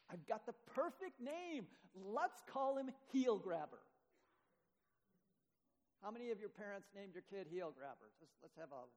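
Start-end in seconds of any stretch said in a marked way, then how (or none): 0.1-3.8 proud father. Acted. Isaac proudly names the baby.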